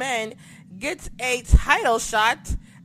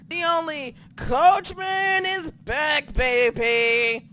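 hype announce; 5 semitones higher, much slower than usual. Acted. Hyping the returning announcer.

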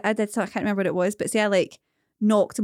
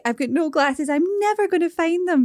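drama queen wail; 8 semitones higher than usual. Retold or imitated. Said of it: "pure upset 'cause he's like".

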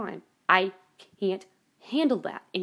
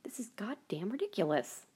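softer whisper of disgust; 8 dB quieter than usual. Retold or imitated. Complaining about mass shootings, whispered disgust. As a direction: hiss it under your breath. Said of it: "I'm like".

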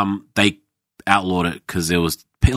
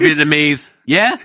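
high clipped waiter voice; mock waiter; 8 semitones higher, 4 dB louder, slower than usual. Imitating a curt waiter greeting diners, high and loud.

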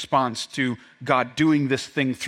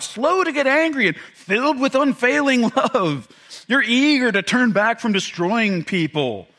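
high, whining complaint at God; a sulking prophet griping to the Lord; whiny complaining Jonah; 9 semitones higher, 3 dB louder than usual. Acted. Jonah whining at God about his mercy, high and petulant. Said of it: "you gotta catch the tone here".

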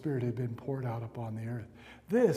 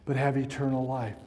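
rising, louder pastoral prayer over music; fervent prayer; 5 dB louder than usual. Real, not acted. Pastor praying over the church, fervent and rising. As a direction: pray it with building authority.

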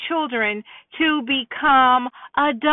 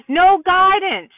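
ordinary talk, nothing marked

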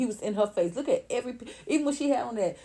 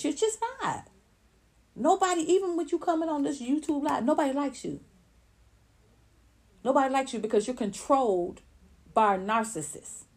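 scornful putdown; 6 semitones higher, 3 dB louder than usual. Real, not acted. Berating Latasha directly.

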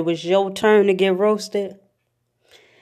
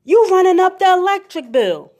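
grandma scolding; 9 semitones higher than usual. Retold or imitated. Grandma scolding about bills.

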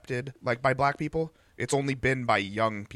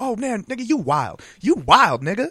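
mock slang voice; 8 semitones higher, 7 dB louder than usual.

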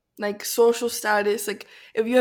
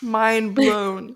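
usual speaking voice

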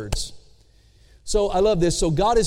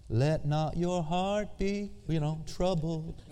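quiet, slow and sugary, like a greeting card; gentle greeting-card recital; soft postcard reading; 9 dB quieter, slower than usual. Shown as a demonstration. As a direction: read it softly like a Hallmark card verse. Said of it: "that sounds really good on a postcard".

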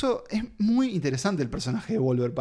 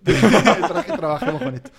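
laughing; 4 semitones higher, 8 dB louder, slower than usual. Real, not acted. Laughing at the joke quote.

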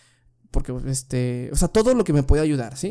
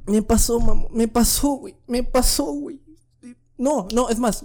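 excited; 8 semitones higher than usual. Real, not acted. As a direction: celebrate the good news. Excited he passed. (emocionado y agudo)

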